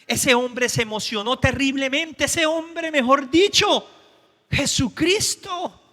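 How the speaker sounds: fervent revival preaching; preaching at full force. Acted. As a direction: stir the crowd up. Preacher shouting with music.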